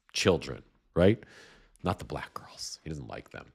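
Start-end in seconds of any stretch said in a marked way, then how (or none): 1.8-3.4 sarcastic stage whisper; whispered aside. Acted. Host mocking Trump's racism to viewers, whispered aside. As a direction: drop to a conspiratorial whisper.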